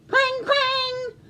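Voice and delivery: high sing-song clang; bell clanging. Acted. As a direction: ring out like alarm bells. Imitated bells.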